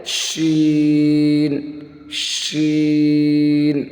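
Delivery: شین with a sibilant "sh" and long "ee"; slow model recitation of شین; whistling sheen. Shown as a demonstration. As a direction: stretch شین out on a level note. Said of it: "اس کو کیسے پڑھیں گے".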